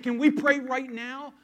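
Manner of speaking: slow, ringing Can we pray right now; eager, emphatic offer. Retold or imitated. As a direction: make the offer ring out with conviction. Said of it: "I got this one".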